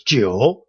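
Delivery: "九" dipping down then up; low falling-rising "九"; third tone. Shown as a demonstration. Teacher showing nine by itself, "九" in third tone. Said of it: "that is the third tone".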